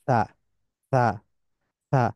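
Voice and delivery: সা with the tongue tip between the teeth, like English th. Shown as a demonstration. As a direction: let air slip out gently on সা. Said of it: "সা এর উচ্চারণ".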